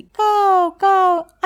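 high, loud cawing; two high crow calls. Acted. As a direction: caw like a young crow. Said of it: "అని అరవమంది".

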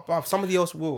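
usual speaking voice

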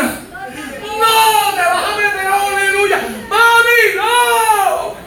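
high pleading shout; high pitched pleading cries; retold yelling. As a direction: cry out to stop mother. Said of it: "Mano, usted no se imagina".

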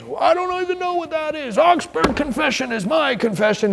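high mocking voice of a careless believer; mock shallow Lutheran. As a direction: send up a smug churchgoer, high-pitched.

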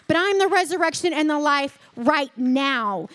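loud, commanding voice; Jesus at the tomb, loud and commanding; authority. Retold or imitated. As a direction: order the stone away like a commander. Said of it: "some authority in his voice".

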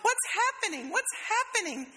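very high, squeaky mock panic; hammed-up tiny bud in distress; panicking flower bud. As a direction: squeal as if coming apart. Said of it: "It's like".

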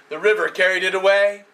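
ordinary delivery

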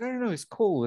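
brushing off a coworker's question, lightly; quoting herself. Retold or imitated. Retelling the gym chat, high shrug of a line. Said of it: "I was like".

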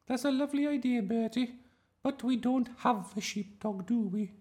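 very high, loud teacher voice; patient schoolteacher. A narrator's reading. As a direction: humour the child in a high-pitched voice.

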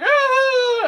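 high loud yelp of pain; child's yelp. Retold or imitated. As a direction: yelp like a scratched kid. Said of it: "he'll be like".

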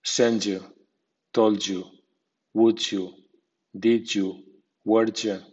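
"did you" said as "didju"; j blend. Shown as a demonstration. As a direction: run d and y together into a j sound: "told you" like "tolju". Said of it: "D más I suena j".

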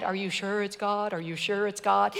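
low, soft, doubting mother in her head; a hint of mother's worried voice. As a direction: echo a worried mother's question. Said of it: "I can hear my mother's voice in my head".

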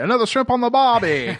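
a horrible mock Australian twang on the Barbie; bad Australian accent. Host jokes to his guest in a horrible Australian accent. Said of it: "my horrible accent".

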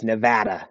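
"Nevada" with a flat "a" as in "cat" in the middle; stressed middle of "Nevada" rhyming with "had"; local pronunciation of Nevada. Shown as a demonstration. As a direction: say "Nevada" as nuh-VAD-uh. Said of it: "however people want to pronounce it".

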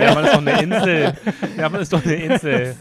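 loud, laughing outburst. Real, not acted. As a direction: blurt it out laughing. Lachender, aufgedrehter Ausruf. (lachend und laut)

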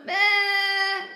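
put-on very loud, high child's bleating wail; bawling. A narrator's reading. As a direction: bawl it out, held long. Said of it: "brult kleine Jan".

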